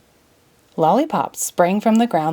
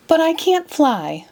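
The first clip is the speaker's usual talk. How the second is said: protesting; 9 semitones higher, slower than usual. Acted. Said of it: "Alice protested".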